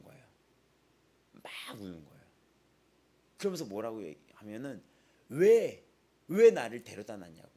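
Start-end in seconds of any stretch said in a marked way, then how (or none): 5.3-7.5 put-on loud, wailing cry of a protesting child; crying child's yell. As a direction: howl it out like a child sobbing in protest. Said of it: "막 우는 거죠". (우는 아이처럼 크게 외치며)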